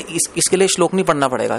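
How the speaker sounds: ordinary talk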